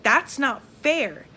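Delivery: retold protest, drawn-out and emphatic on "not fair"; indignant protest. Mom fighting the staff for her daughter, imitated indignant protest.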